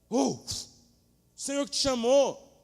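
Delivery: loud, high cry; fervent shout. Acted. Preacher shouting to his own soul, loud high cry.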